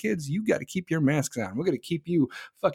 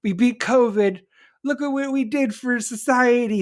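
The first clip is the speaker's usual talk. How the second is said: loud, higher gloating mock voice; smug Democrats; 6 semitones higher, 5 dB louder than usual. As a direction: ham up a self-congratulating politician. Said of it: "Democrats are sitting there like".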